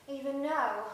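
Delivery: ordinary talk, nothing marked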